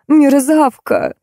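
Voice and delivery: high, drawn-out insult; angry shouting after. A narrator's reading. Actress hurls insults after fleeing make-up artist, angry. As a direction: fling it slowly after someone fleeing. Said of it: "неслось вслед".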